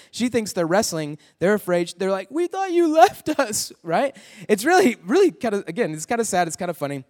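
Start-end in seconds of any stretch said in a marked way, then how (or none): 2.3-3.7 put-on whiny scared kid voice. Scared boys to their father.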